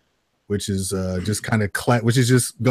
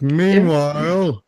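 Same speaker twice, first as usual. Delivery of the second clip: drawn-out, high serial-narrator parody; mock announcer greeting; 7 semitones higher, 4 dB louder, much slower than usual. Co-host greeting listeners with the running gag, mock announcer. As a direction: stretch it slowly like a cheesy announcer.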